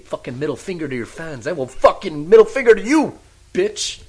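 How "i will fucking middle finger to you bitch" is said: high, loud furious rant; angry outburst. Real, not acted. Fan venting at the wrestler who left, loud angry rant. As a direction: spit it at him like a betrayed fan. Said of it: "I'm a little emotional about it".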